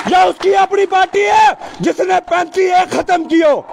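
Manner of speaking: ordinary talk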